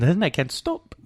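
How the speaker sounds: put-on German accent. Shown as a demonstration. Host explaining he can't stop, in a German accent. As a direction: confess it still in mock German English. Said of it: "I start talking like this".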